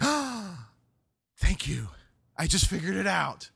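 gasp, then loud, amazed relief; light bulb going on; sudden realization. Acted. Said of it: "Peter's light bulb went on".

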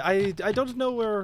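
put-on high-pitched, botched Spanish accent; attempted Spanish accent. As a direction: try a Spanish accent and miss. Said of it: "will never be able to do a Spanish accent".

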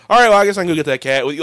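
quick loud overeager yes; eager agreement. Retold or imitated. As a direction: rattle it off, instantly keen.

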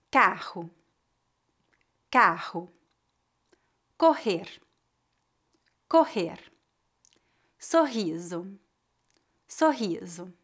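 "Rato" with a throaty h-like R from the throat; teacher models the guttural R, then says "rato" again. Shown as a demonstration. Teacher models words for students to repeat, throaty R. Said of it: "Repita depois de mim para praticar".

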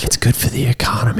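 whispering. Acted. Jokey whispered slogan.

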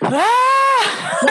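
sharp, high startled yelp; sudden cry. Retold or imitated. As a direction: let out a sudden crying yelp in the car. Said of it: "I was like".

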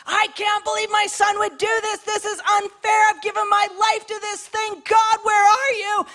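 panicked high complaint rising to a shout; mock meltdown, voice climbing; frantic outburst ending in a cry; freaking out. Freaking out in distress, acted.

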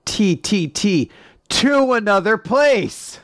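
high, slow mock-grand proclamation; hammed-up eureka moment; triumphant realization. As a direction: draw it out high like a big announcement. Acts out a sudden realization.

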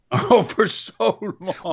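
high, drawn-out laughing repeat; amused, stretched-out echo through a laugh. Real, not acted. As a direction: laugh it out slowly, echoing the joke. Amused echo of co-host's line.

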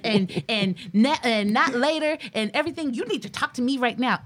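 a hammed-up angry wife; mock angry demand. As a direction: push hard to talk it out now. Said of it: "I used to get angry about that and be like".

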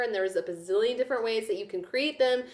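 normal talking voice

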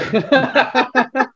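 high burst of laughter; real laugh. Real, not acted. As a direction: crack up laughing.

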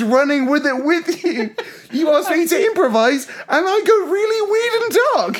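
real laughing shout at his own dark improv; laughing outburst. Real, not acted. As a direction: cry out in amused self-mockery.

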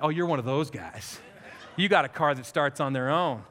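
a low, disdainful put-down under the breath; sneering inner judge. Retold or imitated. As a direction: pastor mocking his own snap judgment in a low sneer. Judging stranger, sneering inner voice.

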